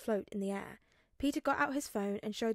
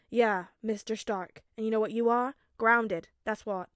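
stern dad; 7 dB louder than usual. A narrator's reading. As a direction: lay down the punishment. Tony scolding Peter.

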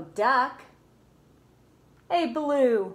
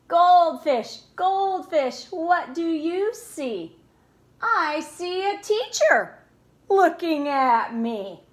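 plain reading voice

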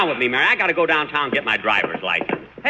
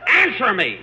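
higher-pitched, insistent outburst; exasperated demand; 7 semitones higher, slower than usual. Acted. Boss presses the silent young singer for an answer, exasperated.

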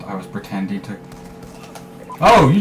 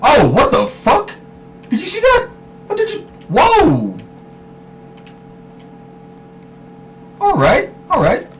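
shocked outburst; 4 dB louder than usual. Real, not acted. Sudden surprise in gameplay.

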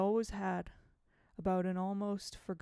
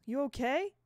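high, louder sudden blurt of her name; 7 semitones higher, 7 dB louder than usual. Acted. Will blurting out to his teacher, high and loud. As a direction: blurt it before you can stop yourself. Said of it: "blurted Will".

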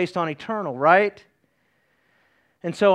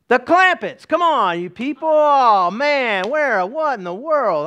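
higher, playful scolding; mock exasperation; 4 semitones higher, slower than usual. Preacher teasing congregation for missing a trivia answer, mock exasperated. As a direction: cry out in pretend disbelief at them.

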